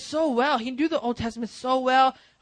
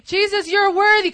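mock worship line; 9 semitones higher, 9 dB louder than usual. Shown as a demonstration. Example of empty worship.

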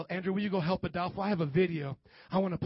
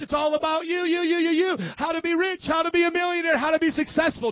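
high, loud sing-song list; high-pitched, insistent mock sales patter; mocking hype; 8 semitones higher, 6 dB louder than usual. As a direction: parody a self-help pitch, rising in pitch. Mocking self-centred church pitch.

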